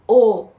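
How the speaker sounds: او loud and round, a pure "o"; clear O. Shown as a demonstration. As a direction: project او as an isolated "o".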